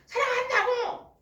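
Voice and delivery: loud, high, drawn-out retort; mock an exasperated husband insisting on love; exasperated declaration. Husband snapping back at wife's question.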